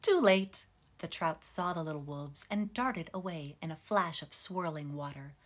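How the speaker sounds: ordinary delivery, nothing marked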